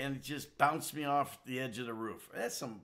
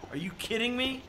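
ordinary talk

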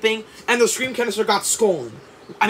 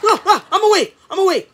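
higher, louder startled wake-up cry; startled awake; 11 semitones higher, 6 dB louder, faster than usual. Acted. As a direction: jolt awake in a panic. Jolted awake by alarm.

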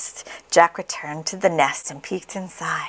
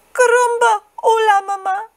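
put-on very high, loud squeaky baby voice; very high baby chick hello; newborn chick; 4 dB louder than usual. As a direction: greet mama as a baby chick. Newborn chick greets Jack.

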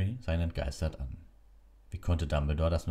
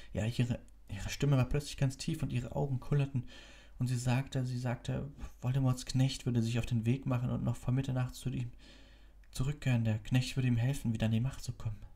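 put-on higher, boyish and urgent; lightly voiced worried boy; young Harry voice; 9 semitones higher than usual. A narrator's reading.

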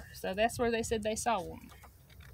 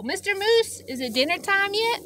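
eager Rabbit; 9 semitones higher, 6 dB louder than usual. Acted. Rabbit's excited high call.

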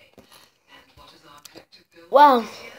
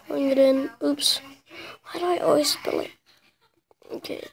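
ordinary delivery

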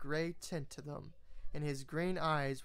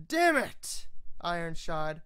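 high, loud angry call; exasperated shout; 3 semitones higher, 4 dB louder than usual. Acted. Captain shouting at the smith through the smoke, exasperated. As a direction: yell into the smoky room.

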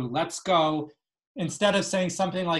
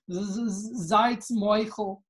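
higher, stuttering through mock tears; tearful stammer; 5 semitones higher, slower than usual. A narrator's reading. Hagrid apologizing as he cries, acted tearful stammer. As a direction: stammer on the z, then draw it out high. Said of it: "he starts to cry".

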